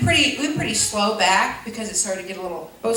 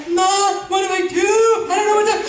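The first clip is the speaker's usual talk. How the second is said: put-on panicked kid; 7 semitones higher than usual. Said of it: "I was just like".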